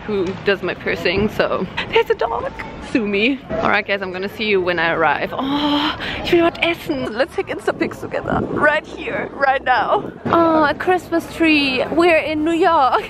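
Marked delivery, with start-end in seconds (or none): 1.9-2.5 much higher excited squeal. Real, not acted. Excited at a dog in street. 5.3-13.0 higher, giddy excited chatter; gushing with excitement. Real, not acted. Excited, high voice in city.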